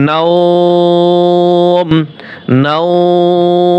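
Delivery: নাওম with the আও held very long before ম; long stretched vowel. Shown as a demonstration. Teacher demonstrating the pause on নাওম. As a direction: stretch নাওম slowly to the stop. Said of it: "ওয়াকফ করলে কিরকম হবে".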